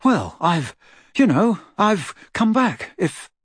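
put-on hesitant, embarrassed teen boy; awkward Ron. A narrator's reading. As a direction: stumble over it awkwardly.

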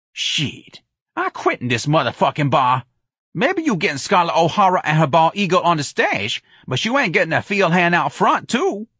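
high, emphatic, put-on protest; defiant Jones character. A narrator's reading. Jones quitting the bar in protest, defiant. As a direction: refuse indignantly, climbing in pitch.

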